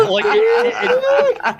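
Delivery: high, loud laughing words; can barely talk for laughing. Real, not acted. Trying to explain while laughing.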